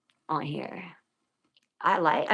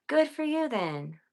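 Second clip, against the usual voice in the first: sarcastic; 8 semitones higher, slower than usual. Real, not acted. Sarcastic jab at trolls.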